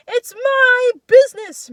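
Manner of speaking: very high, slow bellow; drawn-out furious roar at full force; enraged shout. A narrator's reading. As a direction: scream it, stretching the words. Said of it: "Misha shouts".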